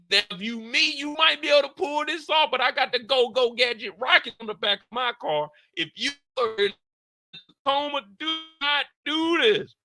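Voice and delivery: normal talking voice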